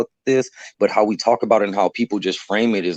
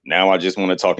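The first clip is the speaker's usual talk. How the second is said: usual speaking voice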